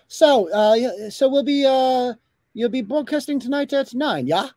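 mock Laura, rising yeah; imitating Laura. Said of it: "She goes".

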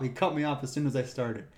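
normal talking voice

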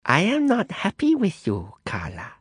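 high, cold voice of an imperious woman; a hidden Madame Zhou rebuking Carla, high and cold; displeased old matriarch. Acted. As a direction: scold her from the dark, icy and displeased. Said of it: "a voice said from behind the grill".

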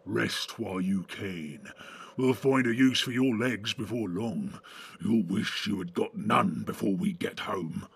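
low, slow sneering orc. A narrator's reading. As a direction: growl it like a cruel orc. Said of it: "made almost as hideous".

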